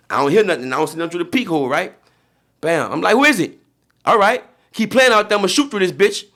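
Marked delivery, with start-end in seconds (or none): 3.1-3.6 loud, higher "who is it"; calling through the door. Retold or imitated. Through the hotel door to the knocker, loud and high. 4.0-6.2 threatening the knockers. Retold or imitated. Yelling at pranksters outside his hotel door, threatening.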